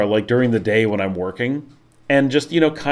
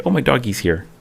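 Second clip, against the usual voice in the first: ordinary talk, nothing marked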